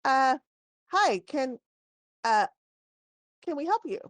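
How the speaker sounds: high, hesitant little gnome voice; a small gnome craning up at a tall customer; lightly voiced gnome asking if he can help; gnome shopkeeper. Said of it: "cranes his neck and he says".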